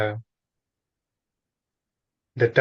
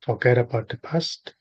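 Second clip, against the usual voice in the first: spaced-out phrases with long silences between; guided meditation. Real, not acted. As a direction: guide a listener gently into stillness, unhurried.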